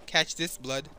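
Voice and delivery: normal talking voice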